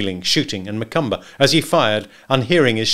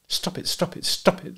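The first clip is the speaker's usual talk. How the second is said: high, breathless sobbing plea; crying out; 7 semitones higher, 5 dB quieter than usual. A narrator's reading. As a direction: cry it out, voice high and broken. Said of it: "the woman cried".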